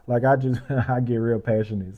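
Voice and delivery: ordinary talk, nothing marked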